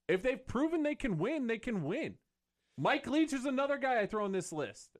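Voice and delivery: fired-up high rant. Real, not acted. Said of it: "go on my rant".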